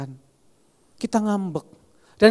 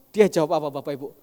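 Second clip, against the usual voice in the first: ordinary talk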